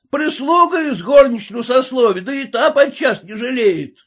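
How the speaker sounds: high, loud abusive yelling; yelling peasant. A narrator's reading. Red-faced peasant yelling after the lady, high loud abuse. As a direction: shout insults at the lady.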